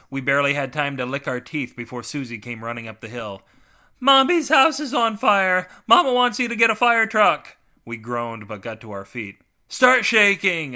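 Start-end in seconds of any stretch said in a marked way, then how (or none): 4.0-7.6 put-on high, loud child raising the alarm; Susie. A narrator's reading. Susie runs up with alarming news. 9.7-10.8 slow, high, loud holler; hollered order. A narrator's reading. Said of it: "I hollered".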